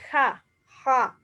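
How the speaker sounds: ja said with a breathy h, not a Spanish jota; the sound ja. Shown as a demonstration. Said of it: "amo unca jota pan nahuatl".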